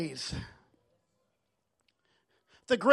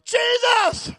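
high, drawn-out calling out; imitated yell; 11 semitones higher, 5 dB louder than usual. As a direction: act out the beggar crying for attention. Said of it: "the more he yelled out".

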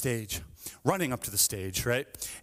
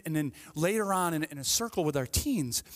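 normal talking voice

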